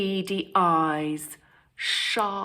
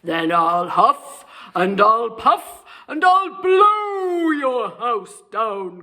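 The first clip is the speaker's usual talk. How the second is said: menacing wolf; 3 semitones higher, 8 dB louder than usual. Acted. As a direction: build the threat to a shout. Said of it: "cried the wolf".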